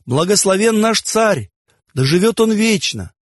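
high, ringing cry of praise; crowd's acclaim. A narrator's reading. Whole crowd hailing their Pharaoh, high acclaiming cry. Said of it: "закричали все".

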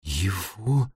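hushed, halting horror; barely audible. A narrator's reading. Jenni staring into Thomas's mouth, barely audible. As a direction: break it apart, hardly audible. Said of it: "произнесла она едва слышно".

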